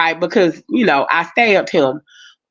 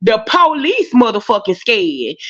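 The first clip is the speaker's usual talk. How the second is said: ordinary talk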